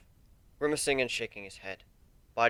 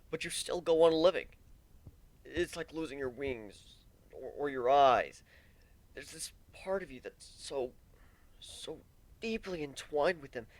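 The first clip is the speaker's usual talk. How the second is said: higher, halting pained voice; Warren, raw grief; 6 semitones higher than usual. Acted. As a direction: push through the hurt word by word.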